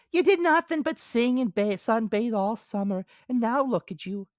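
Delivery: high squeaky ant character. Acted. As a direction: voice the ant as small and sensible.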